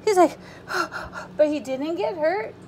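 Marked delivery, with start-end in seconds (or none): 0.7-1.3 acted winded gasps; out-of-breath panting. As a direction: pant like a winded little letter. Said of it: "all out of breath".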